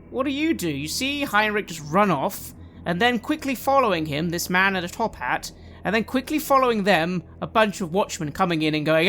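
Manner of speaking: ordinary talk, nothing marked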